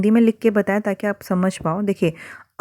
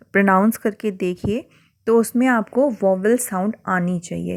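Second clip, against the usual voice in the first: usual speaking voice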